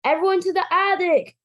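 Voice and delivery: put-on a harried dad hustling the kids out; hurried father. Father herds bouncy boys upstairs.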